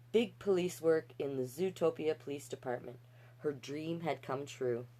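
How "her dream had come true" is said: normal talking voice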